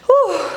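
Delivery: high, puffed-out "whew"; out-of-breath whew. Real, not acted. Coach winded after the last rep, breathless whew. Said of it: "I'm out of breath".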